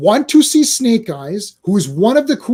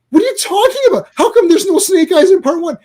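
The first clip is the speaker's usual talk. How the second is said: much higher, incredulous outburst; can't believe what he's hearing; exasperated; 9 semitones higher, 4 dB louder than usual. Real, not acted. Ranting to co-hosts about a film with no Snake Eyes, exasperated.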